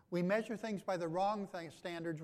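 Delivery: normal talking voice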